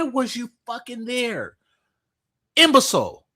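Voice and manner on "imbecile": loud insult. Real, not acted. Insult shouted in rant.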